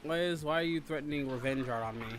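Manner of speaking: ordinary delivery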